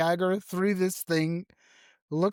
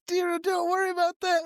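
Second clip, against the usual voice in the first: very high mock of Gus's line; teasing high-pitched parody of Gus; 13 semitones higher, faster than usual. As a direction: squeak the line to tease Gus. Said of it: "Gus says".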